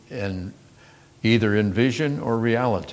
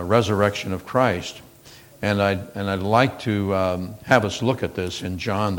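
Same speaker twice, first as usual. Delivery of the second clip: ordinary talk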